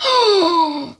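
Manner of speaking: high, sharp mock gasp. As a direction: gasp in shock. Retold mother gasps.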